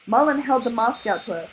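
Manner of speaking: ordinary delivery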